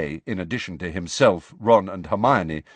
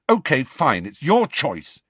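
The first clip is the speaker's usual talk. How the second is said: short and clipped, louder; curt; 5 semitones higher, 5 dB louder than usual. A narrator's reading. Harry to his friends, curtly giving in. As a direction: give in curtly. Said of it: "he said curtly".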